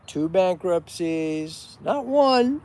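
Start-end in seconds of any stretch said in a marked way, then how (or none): 1.8-2.6 not one, high and loud; emphatic correction; stress on not one. Real, not acted.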